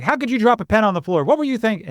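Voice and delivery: loud, high pretend scolding; staged angry scolding for a fire drill; mock outburst. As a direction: fake a sudden blowup over a dropped pen. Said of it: "that just popped out".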